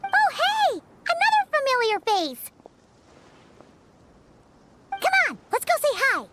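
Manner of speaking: high, bright-eyed eagerness; excited greeting. Acted. Companion spotting another familiar face, excited. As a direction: pipe up eagerly, pitched high.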